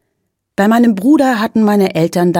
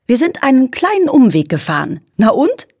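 higher, breezy mum voice; cheerful mother; 6 semitones higher than usual. A narrator's reading. Mother brushing off father's worry about the detour, higher and carefree. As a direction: shrug it off lightly.

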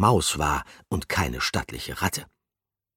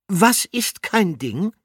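curious question; 6 dB louder, slower than usual. A narrator's reading. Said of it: "fragte Gruyère".

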